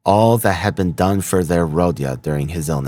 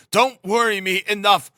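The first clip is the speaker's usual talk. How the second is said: high, strained plea to be left alone; irritable. A narrator's reading. Sick son sending his family away, high exasperated protest. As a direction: drive them off fretfully, drawing out the end. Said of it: "he repeated irritably".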